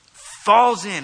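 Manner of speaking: loud, slow, high burst. Real, not acted. Preacher picturing the church falling in, loudly emphatic. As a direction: throw it out like a command. Echoes the shouted military command.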